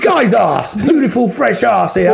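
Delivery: loud, high market trader's call; street hawker. Pretend. Hawker touting a friend's arse to passers-by, mock market cry. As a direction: holler to the crowd like a barker.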